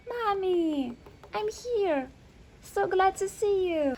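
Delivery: put-on high, eager little boy greeting mommy; a small son, thrilled to see mom; little boy calling happily to his mother; child voice.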